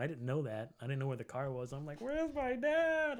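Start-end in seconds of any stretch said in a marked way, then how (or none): 2.0-3.2 put-on high, slow, drawn-out lost child; lost child outside, mock panic, high; panicked kid. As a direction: wail it like you lost your parent. Lost kid after haunted house.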